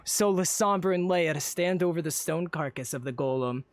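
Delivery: usual speaking voice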